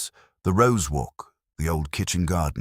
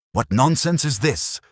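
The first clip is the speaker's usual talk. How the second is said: sharp, high angry bark; 11 semitones higher, 5 dB louder than usual. A narrator's reading. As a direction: snap it out angrily. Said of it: "he barked".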